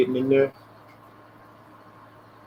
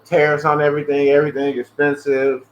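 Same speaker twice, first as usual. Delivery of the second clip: normal talking voice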